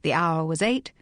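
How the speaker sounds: usual speaking voice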